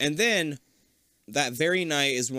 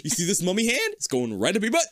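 high-pitched comic threat; mock threat; 7 semitones higher than usual. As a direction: play an overprotective mummy dad threatening. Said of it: "threatened the shit out of Shaggy".

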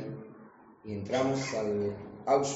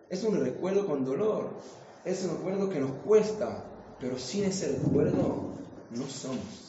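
rising emotion; 6 semitones higher than usual. Real, not acted. Sermon climax on painful memory.

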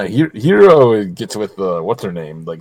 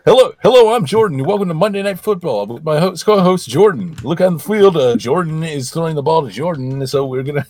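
high, bright TV announcer voice; mock sports announcer; 4 semitones higher than usual. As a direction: pump it up like a host. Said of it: "Be like".

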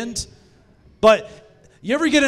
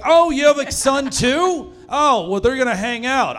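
chatty mom; 6 semitones higher, 3 dB louder than usual. Acted. Moms acted, chatting at work.

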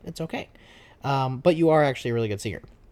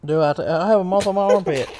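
higher, louder country twang; put-on country accent; 3 semitones higher, 5 dB louder than usual. Shown as a demonstration. Kid says moth on armpit, country-accent reply. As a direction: answer in a surprised country accent. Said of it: "I talk like this".

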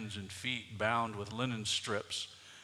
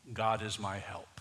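ordinary talk

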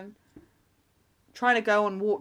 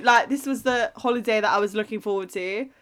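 ordinary talk, nothing marked

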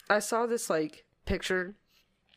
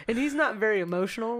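ordinary delivery

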